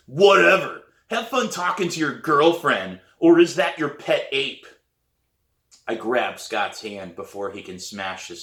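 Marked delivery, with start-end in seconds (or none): 0.1-4.7 loud, higher, scornful jeer; scowling. A narrator's reading. Danny to Scott after table laughs, loud scowling mockery. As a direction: throw the taunt back loudly. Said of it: "Danny scowls and says".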